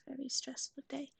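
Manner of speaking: slow breathy whisper, drawn out. Acted. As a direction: whisper it slowly, soothing. Whispers about dog's day.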